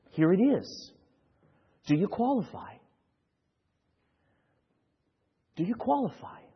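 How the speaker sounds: soft, gentle invitation; tender appeal. Acted. Pastor inviting sinners to Christ, hushed and tender. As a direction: offer grace quietly.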